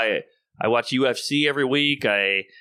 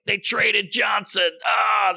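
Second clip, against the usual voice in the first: higher, slow mock shout about the trade; mock sports fan yell; 8 semitones higher, much slower than usual. Mocking sports fans yelling over a traded player, hammed-up shout. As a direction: ham up an outraged fan yelling. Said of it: "these people were like".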